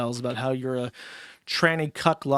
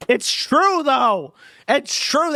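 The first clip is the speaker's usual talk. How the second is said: shrill yelp; 10 semitones higher, 6 dB louder, much slower than usual. Acted. Shrieking agreement.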